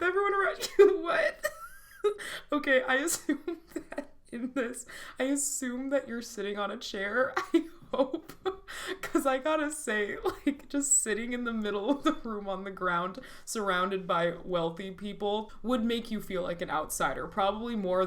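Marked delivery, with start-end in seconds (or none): 0.0-1.6 incredulous crack-up by the end; breaking into laughter. Real, not acted. Reader cracks up at absurd line. 2.5-13.2 real giggles, higher pitch; laughing through words. Real, not acted. Laughing commentary on the absurd line.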